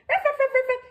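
high, playful little dog barks; puppy barking. Acted. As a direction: bark like an excited puppy. Millie the dog puppet barks.

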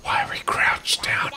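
whispering. Real, not acted. Sneaking up, hiding together.

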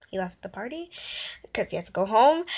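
ordinary talk

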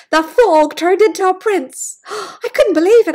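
loud, high astonished telling with a gasp; excited amazement. Acted. Parent to child about the transformation, loud amazement. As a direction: sell the surprise.

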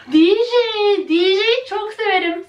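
thrilled, shouting her favourite; excited squeal. Real, not acted. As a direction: burst out with joy. Excited about her doll pick.

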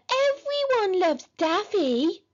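put-on high, drawn-out whiny child; small child's wheedling voice; pleading little brother. A narrator's reading. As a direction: plead in a babyish sing-song, very slowly. Peter's child voice continues.

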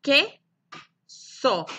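"Queso" broken as que, pause, so; rising first beat, falling second in "Queso"; separate syllables. Shown as a demonstration. Teacher models both syllables, "Queso" split apart. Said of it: "La sílaba que y la sílaba so".

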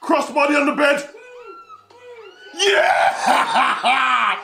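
loud, high shouted call; excited cheer. Real, not acted. Streamer cheering a crossbody on the bed, excited shout. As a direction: call the move and whoop.

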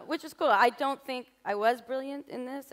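ordinary talk

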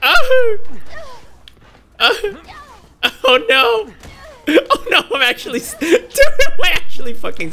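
high, loud yelps and laughter; laughing panic. Real, not acted. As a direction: yell helplessly through laughter while stuck. Player stuck being hit repeatedly.

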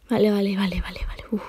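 hushed, tense whisper. Real, not acted. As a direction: whisper to calm yourself down. Tense moment, calming self.

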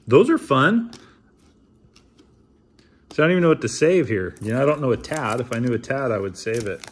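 ordinary talk

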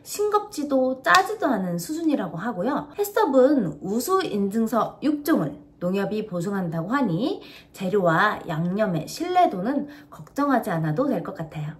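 광고 문구 읽듯 또박또박; 홈쇼핑 내레이션처럼 설명하기; product ad read. Acted. (시청자에게 세 가지 양념 맛을 소개하는 광고 낭독)

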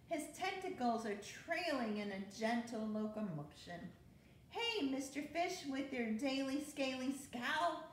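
lively storybook read-aloud; bouncy rhyming picture-book narration; reading to children. A narrator's reading. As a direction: read it like bedtime story time for kids.

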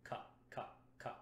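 "Ka, ka, ka" as crisp breathy back-tongue clicks; clean k sounds. Shown as a demonstration. Brass teacher demos a clean back consonant, crisp and unvoiced. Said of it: "something like a K in the back".

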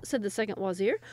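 ordinary delivery, nothing marked

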